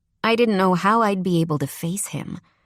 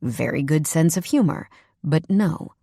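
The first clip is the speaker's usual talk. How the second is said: plain reading voice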